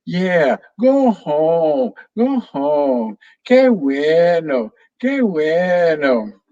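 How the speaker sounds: high parroting, slow stretched qué bueno; parrot repeating. Acted. Parrot Pepe echoes Sophia's call home, high then slow pleased. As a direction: parrot it back cheerfully. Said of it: "Pepe repeated".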